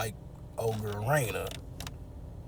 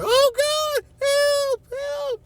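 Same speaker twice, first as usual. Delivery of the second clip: high shrill-free cry of help, much higher and louder; mock scream for help; 6 dB louder, much slower than usual. Trapped girl screaming at the captor for help, mock scream.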